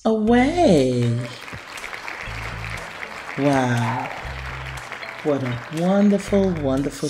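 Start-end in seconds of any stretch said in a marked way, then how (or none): none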